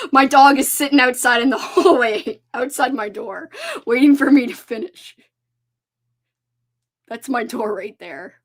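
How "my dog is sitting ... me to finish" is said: louder laughing aside; amused laugh. Real, not acted. Vlogger laughing about her dog, amused.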